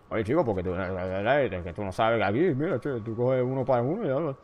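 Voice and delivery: a lightly put-on Cuban Spanish rant, fast at the end; imitating a Cuban teacher explaining math; mock Cuban Spanish. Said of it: "that's pretty much what it becomes".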